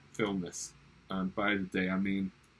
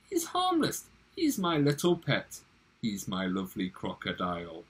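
doting lady. Acted. Lady soothes in recited poem.

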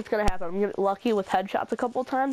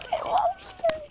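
mock shout. Roast punchline.

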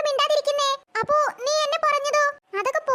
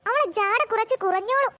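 ordinary talk

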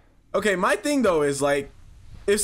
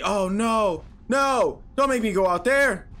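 usual speaking voice

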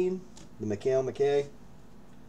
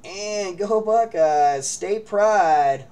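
"go Buckeyes" drawn out slowly, louder and higher; rallying cheer, slow and loud; 8 semitones higher, 8 dB louder, much slower than usual. Real, not acted. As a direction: cheer the team on. Cheering on players.

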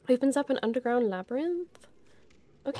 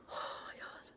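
voiceless, barely there gasp of relief; spent whisper; 22 dB quieter than usual. Real, not acted. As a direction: whisper it, drained after the win. Breathless after the win.

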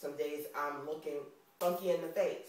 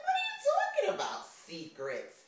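much higher, squeaky fake innocence; mock indignant surprise; 4 dB louder than usual. Retelling her denial to hubby, high mock surprise. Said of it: "I was like".

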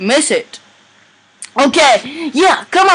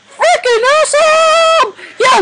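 high excited squeal. Real, not acted. Thrilled gamer squeal.

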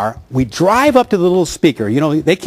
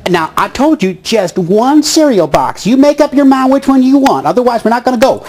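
imitating shopper lady; 6 semitones higher than usual. Boy mimics a supermarket mother scolding her kid, hammed-up. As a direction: nag like a stern shopper mom. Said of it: "talking like the lady that walked just by us".

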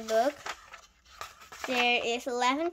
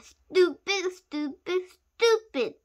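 raised, louder, word-by-word reproach; scolding; 6 semitones higher, 5 dB louder, slower than usual. A narrator's reading. Friend shaking his head at the plan, scolding. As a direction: drive each repeat home.

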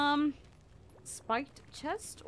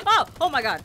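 loud startled outburst; 6 semitones higher, 11 dB louder, faster than usual. Real, not acted. Player scared by what's round the corner, startled yell. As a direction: jolt with sudden fright. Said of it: "Scared me".

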